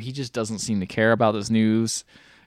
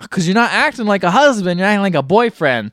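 angry; 6 semitones higher, 4 dB louder than usual. Retold or imitated. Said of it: "She's just like".

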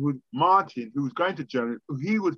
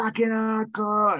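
slow, drawn-out mock hype, pitched higher; hyped rave reaction; 8 semitones higher, slower than usual. Hearing Belgian hardcore records first hand, drawn-out hype. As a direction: savour it like a hardcore fan.

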